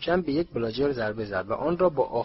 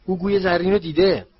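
loud, high excited shout; thrilled spectator's cry; 7 semitones higher, 9 dB louder than usual. A narrator's reading. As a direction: yell it out to the others. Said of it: "هری فریاد زد".

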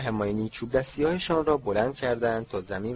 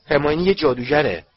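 loud, higher-pitched protest; shouting; 4 semitones higher, 8 dB louder than usual. A narrator's reading. Harry defends his friend against the bully, shouting. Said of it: "هری فریاد زد".

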